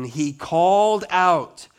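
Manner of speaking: normal talking voice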